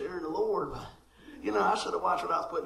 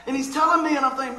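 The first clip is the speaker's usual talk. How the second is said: ordinary delivery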